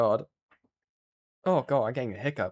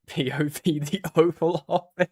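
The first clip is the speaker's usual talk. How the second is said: giggling through a joke; laughing; 6 semitones higher, 4 dB louder than usual. Real, not acted. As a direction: laugh while saying it.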